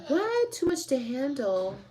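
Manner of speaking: sudden high shocked "What?"; stunned at the news. Real, not acted. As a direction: gasp it out in disbelief. Shocked by comment.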